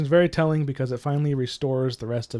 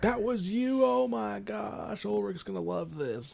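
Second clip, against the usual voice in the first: much higher, delighted voice laughing through it; gleeful villain; 8 semitones higher, 5 dB quieter, slower than usual. Retold or imitated. The henchman realising it was John, high and gleeful. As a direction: savour the discovery with glee. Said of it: "they're like".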